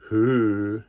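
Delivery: ordinary delivery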